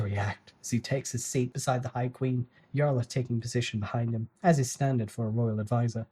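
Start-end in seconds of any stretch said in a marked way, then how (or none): none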